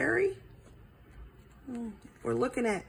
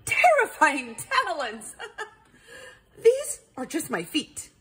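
high, amused disbelief with laughter; laughing; 7 semitones higher than usual. A narrator's reading. Owl dismissing Perry's fright, laughing. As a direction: laugh it off, pitched up. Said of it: "Hubert laughed".

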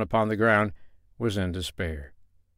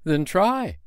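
high, lightly commanding little man; 7 semitones higher than usual. A narrator's reading. Little man urging the girl to try, commanding. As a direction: command it high, easing on the last words. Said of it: "commanded the little brown man".

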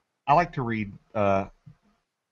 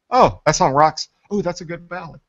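excited listener; 7 semitones higher, 9 dB louder than usual. Retold or imitated. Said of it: "You were like".

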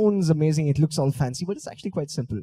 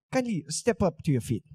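ordinary talk

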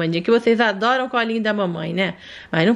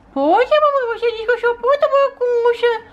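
put-on high childlike voice of a small daughter; sweet little daughter asking mommy for more; little girl voice; 10 semitones higher than usual. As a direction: play the sick little girl talking to mom. Doll protests.

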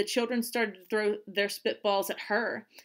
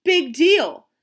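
loud, high defiant jeer; scornful dismissal; 9 semitones higher, 11 dB louder, slower than usual. A narrator's reading. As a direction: brush her off scornfully. Boys brushing off her protest.

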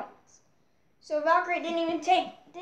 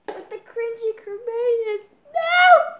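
high, loud mock crying; mock wail; 7 semitones higher, 7 dB louder, slower than usual. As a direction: wail at the loss like a kid. Favourite Beyblade loses the battle.

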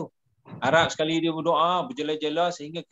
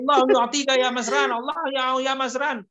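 bossy-sounding prayer; 7 semitones higher, 3 dB louder than usual. Retold or imitated. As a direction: pitch it up like you're giving an order. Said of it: "macam nak mengarah".

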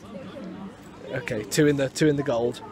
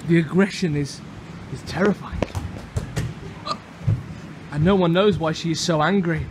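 nature documentary. Pretend. Mock nature documentary narration.

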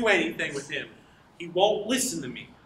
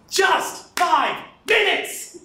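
high, loud, slow yell; exasperated shout; 10 semitones higher, 6 dB louder, much slower than usual. Acted. Man fed up with the chattering girl, exasperated shout. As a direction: stretch it out in exasperation.

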